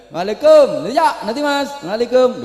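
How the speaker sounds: high, quick-fire street salam; greeting a friend. Shown as a demonstration. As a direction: call out casually to a pal in passing. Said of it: "Ketemu kanca ning dalan".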